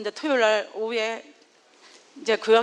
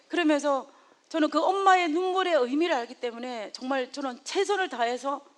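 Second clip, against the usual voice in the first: normal talking voice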